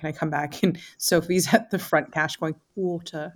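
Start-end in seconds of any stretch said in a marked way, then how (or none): 2.8-3.3 mock British English "water"; English accent. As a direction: imitate a friend's posh English accent. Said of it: "That was a nice English accent".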